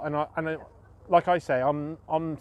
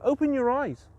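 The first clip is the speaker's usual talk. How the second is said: high-pitched mock scolding; exasperated wife; 10 semitones higher than usual. Blind man quoting his wife about tea bags, mock exasperation. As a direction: imitate a wife's impatient retort. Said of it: "my wife will say it".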